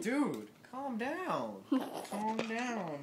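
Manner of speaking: high, drawn-out mock pleading; alarmed placating. Man telling a squawking penguin to back off, placating. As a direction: stretch it out high to calm someone.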